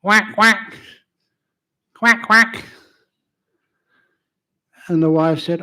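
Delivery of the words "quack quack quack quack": loud nasal-free quack quack, high and bright; imitated duck quacks. Duck noise in story.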